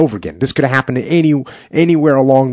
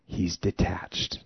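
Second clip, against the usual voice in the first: softer and slow, almost to yourself; quiet, slow aside; 5 semitones lower, 13 dB quieter, much slower than usual. Shown as a demonstration. Host musing on the captain, quiet slow aside. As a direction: let it drop low and slow. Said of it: "repeats word for emphasis".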